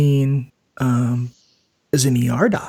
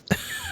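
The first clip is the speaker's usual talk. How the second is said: short laugh; 12 dB quieter than usual. Real, not acted. Laughing at terrible choice.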